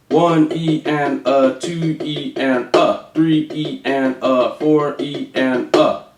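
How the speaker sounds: slow, even count, each syllable on its beat; slow demo count. Shown as a demonstration. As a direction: spell out every subdivision slowly and steadily. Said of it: "Here's how you do it".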